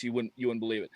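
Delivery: normal talking voice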